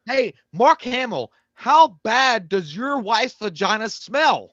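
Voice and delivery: mocking yell at Mark Hamill, slow and high; mocking callout. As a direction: call him out slowly and loudly, taunting. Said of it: "it made me think, like".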